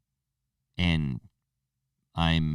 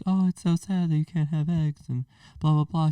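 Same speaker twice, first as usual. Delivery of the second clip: much higher mock girlfriend voice; imitating girlfriend; 11 semitones higher than usual. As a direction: parody the girlfriend's sympathy in a put-on voice. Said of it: "she's like".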